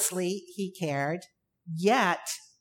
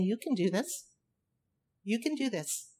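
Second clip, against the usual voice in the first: gentle encouragement; 7 dB quieter than usual. Acted. Jesus encouraging the disciples.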